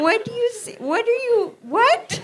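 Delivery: high, laughing disbelief; baffled and cracking up; incredulous laughing retort. Retold or imitated.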